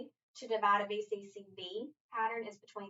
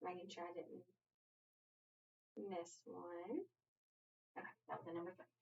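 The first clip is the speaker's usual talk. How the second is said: much softer, as if to herself; quiet self-talk; 3 semitones lower, 17 dB quieter than usual. Real, not acted. Teacher checking she didn't miss one, very quiet self-talk. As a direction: talk under your breath while checking.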